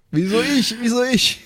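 high, laughing mock panic. Real, not acted. Player panicking over werewolves, high and laughing. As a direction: squeal like it's your doom.

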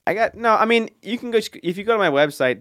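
flustered. Real, not acted. Flustered correction.

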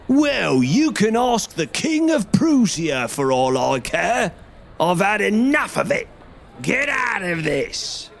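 high, far angry salesman; angry shout. A narrator's reading. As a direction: drive him out with a yell. Salesman drives man away.

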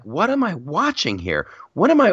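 what am I even watching, building up; incredulous. Real, not acted. Incredulous rant at the movie.

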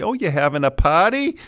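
high-pitched Boston mom, PARTY as "pahty"; mother's Boston accent. Retold or imitated. Mother on a call to the family, high Boston accent. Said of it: "they hear it when my mother calls".

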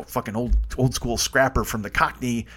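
ordinary talk, nothing marked

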